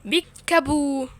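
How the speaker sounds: slowly sung-out playful peekaboo; slow drawn peekaboo. Pretend. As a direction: draw out بيكابو like a teasing reveal. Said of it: "اقول لكم بيكابو".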